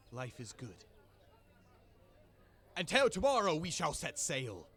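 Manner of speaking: booming jolly pirate captain addressing the crew; rally the crew like a proud captain. Acted. Captain rallies his crew at the feast, jolly and booming.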